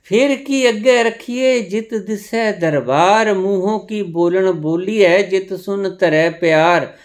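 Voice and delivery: high, loud declaimed verse in an unbroken run; scripture recitation. Acted. (ऊँचे स्वर में गुरबाणी पाठ)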